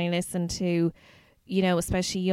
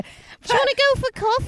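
high, loud, coy put-on voice; Kylie impression; 7 dB louder than usual. Friend imitates Kylie turning down sex, high coy voice. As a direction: chirp the offer like a prim young Kylie. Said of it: "And Kylie would have said".

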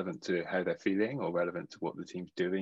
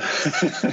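breathy real laugh; burst of laughter; 8 semitones higher, 7 dB louder than usual. Real, not acted. Laughs at Mister Tickle mug.

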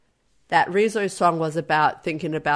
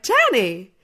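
higher, insistent plea; imitating protesting girlfriend; 8 semitones higher, 5 dB louder, slower than usual. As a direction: act out a girl telling her boyfriend off. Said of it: "say it in my accent".

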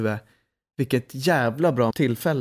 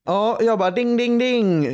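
ding sound; 10 semitones higher, 4 dB louder than usual. Retold or imitated. A lightbulb moment.

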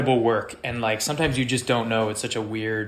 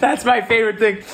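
real laughter breaking into high, loud words; laughing; 11 semitones higher, 6 dB louder than usual. Real, not acted. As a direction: say it through a big laugh. Cracked up by host's anecdote.

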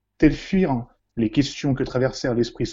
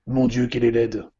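ordinary talk

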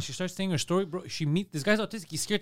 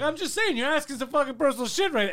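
higher, a touch of indignant protest; mock indignant guy; 8 semitones higher, 4 dB louder than usual. Imitating a dating show guy pushing back, mock indignant. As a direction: defend yourself, lightly hammed up. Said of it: "He's like".

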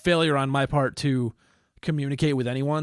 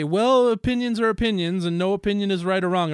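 mocking quote; 6 semitones higher, 5 dB louder than usual. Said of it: "people that try to say".

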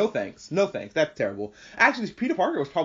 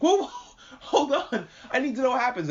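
high, pleading mock protest; alarmed protest; 9 semitones higher than usual. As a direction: beg them to hold on, high-voiced. Acting out protest at session ending.

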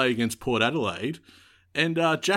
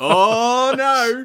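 high, loud laughing groan of mock despair; 11 semitones higher, 8 dB louder than usual. Real, not acted. Co-host cracking up at the Illuminati joke, laughing. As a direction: crack up while protesting, much higher and louder.

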